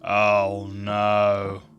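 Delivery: usual speaking voice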